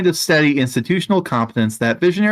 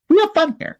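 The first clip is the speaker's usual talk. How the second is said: sing-song boss cheer, much higher; high mock boss cheer; 12 semitones higher than usual. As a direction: chirp it like a fake-happy manager. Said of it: "be like".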